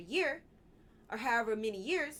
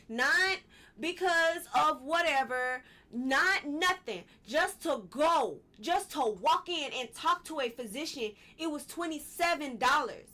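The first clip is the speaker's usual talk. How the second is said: real heated rant, higher and louder; really letting them have it, worked up; angry fussing at the viewers; 5 semitones higher, 5 dB louder than usual. Real, not acted. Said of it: "I'm fussing at y'all".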